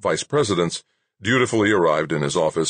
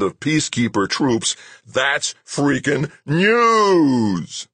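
high, loud bellow, key words drawn out; 9 semitones higher, 5 dB louder, slower than usual. A narrator's reading. Boss bellowing the network's name at his news executive. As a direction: bellow it, stretching NEWS into a long roar. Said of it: "McGrath bellowed".

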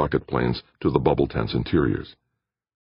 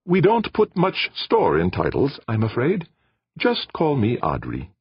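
polite alien voice; 11 semitones higher than usual. Acted. Alien Audrey speaks politely.

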